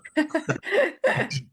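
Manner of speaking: high staccato laugh; high-pitched mimicked chuckle; imitated laughter. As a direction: act out her giggle in short high bursts. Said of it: "she's, like, laughing".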